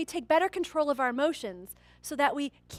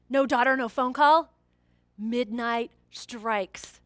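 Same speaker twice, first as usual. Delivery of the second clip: ordinary delivery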